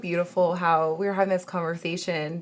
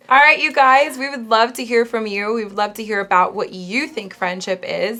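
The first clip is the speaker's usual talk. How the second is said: higher, louder address to the audience; upbeat host sign-off; 4 semitones higher, 5 dB louder than usual. Real, not acted. Host asking viewers to comment and subscribe, upbeat sign-off. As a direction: pitch it up and out to the viewers.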